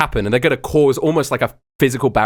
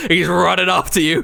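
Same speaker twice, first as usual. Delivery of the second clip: usual speaking voice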